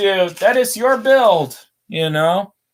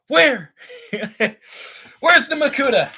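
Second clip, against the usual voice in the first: higher, eager, laughing "Where?"; playful excitement; 8 semitones higher than usual. Pretend. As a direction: hunt for the Makuta like an excited kid. Playful hunt for a villain.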